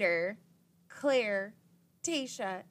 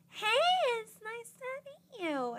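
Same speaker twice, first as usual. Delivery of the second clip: very high, slow, sugary hello; mock innocent newcomer; sweet greeting; 5 dB louder than usual. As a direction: greet rivals with fake sweetness. Heather greets the girls.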